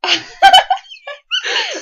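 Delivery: high, loud real laugh; high loud burst of real laughter. Real, not acted. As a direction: crack up out loud. Reacts to arrest reading.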